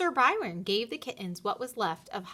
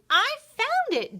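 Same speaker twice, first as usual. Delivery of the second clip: very high, louder squeak; 7 dB louder than usual. A narrator's reading. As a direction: squeak it like the littlest kitten. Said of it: "Dottie squeaked".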